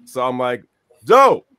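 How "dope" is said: loud, high burst of delight; excited dope. Retold or imitated. Telling casting about the Senegal role, excited. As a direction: blurt it out thrilled.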